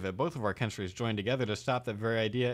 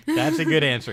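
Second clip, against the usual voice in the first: laughing; 5 semitones higher, 10 dB louder than usual. Retold or imitated. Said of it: "he says, laughing".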